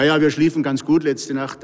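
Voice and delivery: ordinary talk, nothing marked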